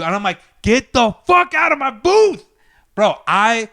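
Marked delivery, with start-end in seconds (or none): 0.6-2.4 high, drawn-out furious order; exasperated yell. Retold or imitated. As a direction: stretch each word, pitch soaring, to throw them out. Acted final order to leave booth.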